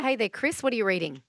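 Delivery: usual speaking voice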